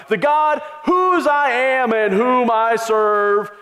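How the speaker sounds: slow, high, grand and loud; lofty biblical phrasing. Shown as a demonstration. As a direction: ham up the old-fashioned holy phrasing. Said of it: "Do you ever talk like that?".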